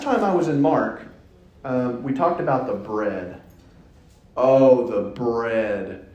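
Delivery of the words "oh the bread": mock lament. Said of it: "mock lament".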